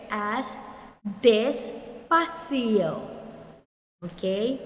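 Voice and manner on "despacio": despacio said slowly, with a lisped th for the c, "despathio"; despacio, tongue between the teeth on the c; pronounce despacio. Shown as a demonstration. Teacher models despacio for learners, slow with a th sound. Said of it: "the despacio here can be expressed as".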